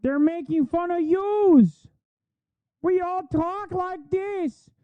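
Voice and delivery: high, slow exaggerated Yooper accent; mock Yooper accent. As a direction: lay the Upper Peninsula accent on thick, mocking. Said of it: "her Yooper accent".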